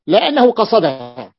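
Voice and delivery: normal talking voice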